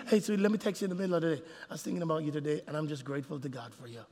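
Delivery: soft, quick then fading loving words; a hint of a husband's soft message; tender text. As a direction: murmur it like a loving note.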